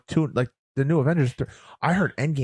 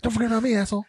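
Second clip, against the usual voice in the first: Ryan Reynolds impression; 12 semitones higher, faster than usual. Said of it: "Ryan Reynolds pops in".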